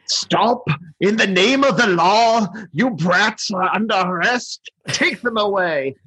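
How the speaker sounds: over-the-top high, pompous tyrant; Burgermeister. Acted. Burgermeister ordering brats arrested, over-the-top commanding voice. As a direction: proclaim it like a hammy official, drawing out the endings. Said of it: "how over the top the Burgermeister is".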